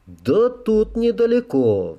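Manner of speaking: slow, high-pitched sighed answer; sighing. A narrator's reading. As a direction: draw it out with a reluctant sigh. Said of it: "вздохнул начальник конвоя".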